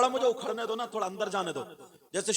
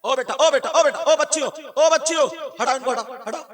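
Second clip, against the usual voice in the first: calling out; 6 semitones higher, 9 dB louder than usual. Real, not acted. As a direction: break off and holler across the crowd. Calls out to children in crowd.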